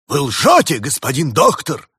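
high, forceful accusing cry; loud accusation. Acted. Spirit accuses the doctor of lying, loud accusation. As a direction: fling the charge out loud and high.

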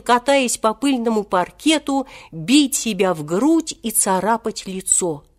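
ordinary delivery

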